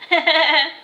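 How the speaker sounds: mother's uneasy little laugh; nervous laugh. Acted. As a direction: laugh it off nervously, covering discomfort. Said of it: "giving a nervous laughter".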